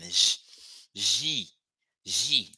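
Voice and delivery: each G said as "zhee", soft "zh" onset; soft French-style G. Shown as a demonstration. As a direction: model G slowly as a soft "zhee". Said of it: "like this".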